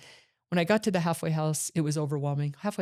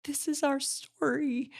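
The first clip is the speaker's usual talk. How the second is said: high, slow, moved voice of the inmate friends; slow and lifted, as if deeply touched; friends in prison; 10 semitones higher, slower than usual. Retold or imitated. They were like.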